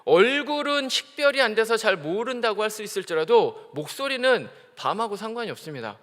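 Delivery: usual speaking voice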